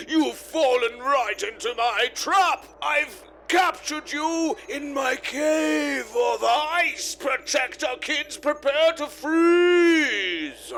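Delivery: booming ice villain with stretched words; gloating villain. Acted. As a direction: declaim it slowly, hammy evil mastermind. Villain gloats in played clip.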